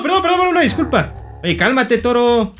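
high-pitched mock begging; panicked apology. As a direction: plead rapid-fire with an attacking bull, voice shooting up. Begs attacking bull for pardon. (súplica aguda, pánico fingido)

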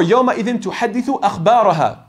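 fast, chanted recitation of يَوْمَئِذٍ تُحَدِّثُ أَخْبَارَهَا; teacher chants the verse before explaining it; Quran recitation. Shown as a demonstration. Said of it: "Ayah number four".

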